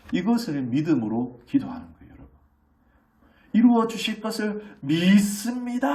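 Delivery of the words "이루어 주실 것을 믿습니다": high, loud, slow churchy prayer voice; showy pious prayer. Shown as a demonstration. Preacher mocks the wrong kind of prayer, high and drawn out. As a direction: ham up an overly pious prayer. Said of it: "이게 기도가 아니고".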